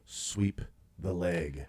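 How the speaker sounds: low, soft, halting order; mock sensei command. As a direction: pause mid-order like a stern sensei.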